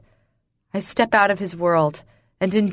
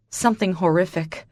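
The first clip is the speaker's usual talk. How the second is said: ordinary delivery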